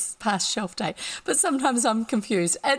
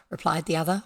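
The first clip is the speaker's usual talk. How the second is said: usual speaking voice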